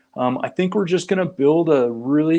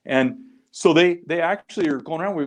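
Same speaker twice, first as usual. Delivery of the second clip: ordinary delivery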